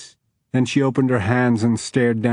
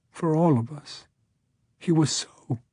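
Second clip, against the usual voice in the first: breaking, tearful voice; crying; 5 semitones higher, 4 dB quieter than usual. A narrator's reading. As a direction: choke out the words crying. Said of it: "She continued to cry".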